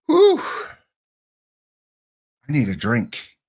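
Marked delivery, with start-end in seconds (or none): 0.0-0.4 sharp high whoosh of relief; loud high whistle of relief; relieved whew. Real, not acted. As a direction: blow it out loud like you survived the clock. Relief after a tense countdown.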